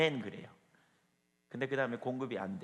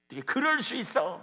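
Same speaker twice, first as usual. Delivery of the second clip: higher, louder outraged protest; acted indignant anger; 9 semitones higher, 7 dB louder than usual. Preacher voicing a wronged believer losing their temper, angry outburst. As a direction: burst out, incredulous and angry. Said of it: "그냥 화를 내요".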